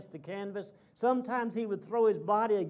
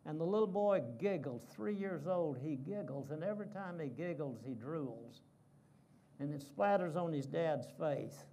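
ordinary talk, nothing marked

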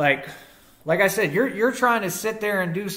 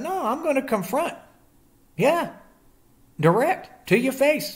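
pitched up, challenging; defiant; 7 semitones higher than usual. Real, not acted. Telling online haters to say it to his face, high and defiant. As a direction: throw down the gauntlet.

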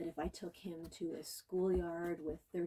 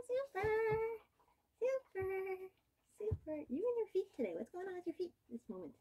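put-on bright baby-talk to the puppy; high puppy talk; 13 semitones higher, 4 dB louder, faster than usual. Trainer cooing to her puppy Watson, very high. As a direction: coo up high at the dog, sing-song.

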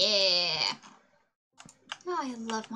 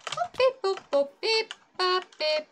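robot-like boop beep; high beeping noises; 8 semitones higher, 5 dB louder than usual. Real, not acted. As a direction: chirp the beeps up high. Soundeffekte beim Bauen.

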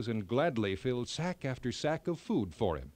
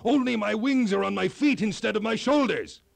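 much higher, louder thrilled cry; cried out; 7 semitones higher, 7 dB louder than usual. A narrator's reading. King Brinna sliding down the mountain, crying out in thrilled delight. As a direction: shout it out with delight while flying. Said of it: "he cried".

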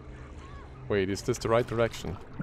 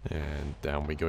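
deep voice; 8 semitones lower, 8 dB quieter than usual. Real, not acted. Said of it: "why is my voice so deep today".

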